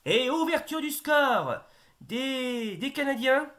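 high, loud goal call building to a shout; excited sports commentary. Acted.

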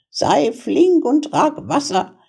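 high-pitched witch giving orders; high, impatient witch voice. A narrator's reading. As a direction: boss the girl about as a wicked witch. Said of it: "rief sie dem Mädchen zu".

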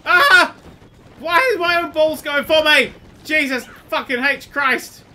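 very loud, high shrieking outrage; outraged scream. Real, not acted. Player hit by his own allies, outraged scream. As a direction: scream in pain and disbelief.

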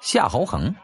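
ordinary delivery, nothing marked

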